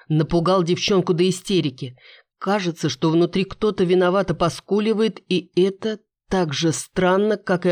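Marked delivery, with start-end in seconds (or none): none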